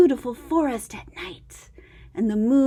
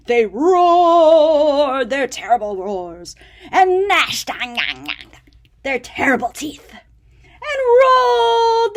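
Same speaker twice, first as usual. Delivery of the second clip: louder, growly storytelling; dramatic read; 6 dB louder than usual. A narrator's reading. Reading the monsters to a child, big and dramatic. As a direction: ham up the terrible monsters.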